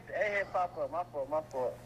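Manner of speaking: normal talking voice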